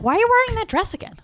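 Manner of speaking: high, fast mock outrage; exasperated question. Viewer to Belle on screen, high fast mock outrage. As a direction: squeak it out in fake disbelief. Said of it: "I'm like".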